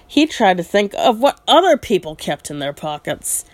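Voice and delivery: usual speaking voice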